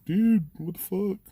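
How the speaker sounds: high, slow startled yelp of a scared cop; a cop bursting out of the room, terrified; panicked cop yelp. Retold or imitated. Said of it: "he come running out like".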